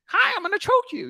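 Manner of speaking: very high, squeaky mock voice; hammed-up meek guy saying something menacing; mock timid guy voice.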